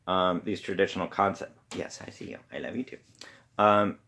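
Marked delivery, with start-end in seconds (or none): 1.7-3.0 hushed, near-whispered aside; very quiet reassurance, much softer than the lecture. Real, not acted. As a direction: murmur it fondly off to the side. Aside to someone nearby, mid-lecture.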